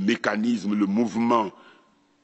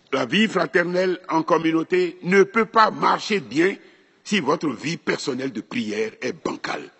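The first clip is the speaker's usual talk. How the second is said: normal talking voice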